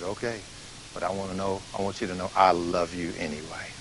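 soft, hushed sincere reply; preacher answers the contractor's refusal, softly; gentle forgiveness. Retold or imitated. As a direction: offer it quietly, meaning it. Reconciling with the angry contractor.

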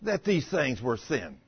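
ordinary talk, nothing marked

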